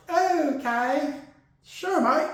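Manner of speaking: put-on a half-hearted British accent, brushing him off; mock British accent. The team brushing off Purdy in a mock British accent. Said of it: "I don't know why they're British".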